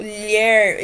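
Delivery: "lher" held long as a single syllable; digraph as one sound. Shown as a demonstration. Teacher explaining the digraph in "lher", drawn-out demonstration. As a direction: stretch "lher" on its own. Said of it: "formam um som só".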